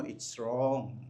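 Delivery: quietly murmured it's wrong to the person beside you; low quiet repeat. Shown as a demonstration. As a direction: say it low and soft to your neighbour. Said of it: "Sabihin mo sa katabi mo".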